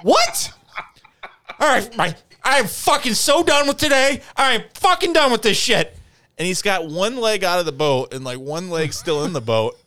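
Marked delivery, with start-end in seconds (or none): 0.0-0.5 very high disbelieving what; can't believe it; incredulous what. Retold or imitated. Narrator hearing about the flip-flop, high incredulous. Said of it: "I said". 1.6-6.0 high, loud fed-up rant; imitating Corey. Corey in the kayak, done with the day, high furious whine. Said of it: "Corey fed up".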